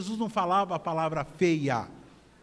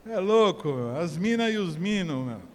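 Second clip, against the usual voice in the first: São Paulo slang accent; paulista accent. Shown as a demonstration. Preacher mimicking São Paulo locals for laughs, paulista accent. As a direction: put on a paulistano street accent. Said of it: "Como que o paulista fala?".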